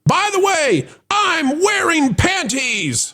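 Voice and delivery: high, drawn-out comic announcement; very high, stretched punchline delivery; Bruce Willis mock confession. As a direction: sing out an absurdly timed confession. Mock Bruce Willis call to NASA.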